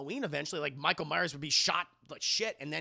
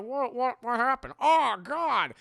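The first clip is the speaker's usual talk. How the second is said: higher, louder startled babble; hammed-up fright; mock panic; 6 semitones higher, 7 dB louder, slower than usual. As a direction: build from confusion to a loud wail. Acts out startled, panicking boyfriend.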